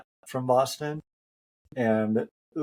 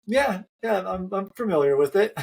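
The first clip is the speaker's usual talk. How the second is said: higher, amused mock nonchalance; playing it cool; 9 semitones higher, 4 dB louder than usual. Race director hiding who he is, playing it cool. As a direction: imitate someone coyly downplaying what they know. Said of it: "like, played it cool".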